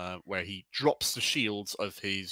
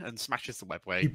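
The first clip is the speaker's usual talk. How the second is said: usual speaking voice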